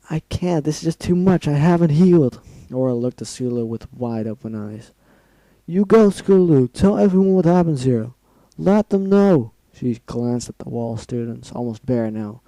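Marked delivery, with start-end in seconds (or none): 0.1-2.4 distressed refusal. Acted. Injured pony refusing to flee. 5.6-9.5 brave farewell. Acted. Wounded pony sending friend away.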